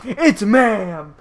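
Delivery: hammed-up angry correction, building in volume; escalating outburst. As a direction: imitate an irate customer, stretching out the last word. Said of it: "The person escalated the situation by saying".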